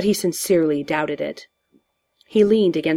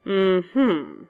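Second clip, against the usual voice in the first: drawn-out doubting hum; Joker. A narrator's reading. Villain doubts hero's denial, knowing hum. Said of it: "said the Joker".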